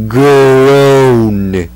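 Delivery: long drawn-out spoken groan; loud groan. Acted. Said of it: "the coyote let out a loud groan".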